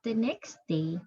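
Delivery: clear, measured storybook narration for a class; storybook reading to children. A narrator's reading. Teacher reading the story aloud to her pupils, steady storybook voice. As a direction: read it like a teacher sharing a picture book.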